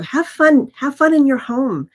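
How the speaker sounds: upbeat. Real, not acted. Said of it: "urging listeners to have fun".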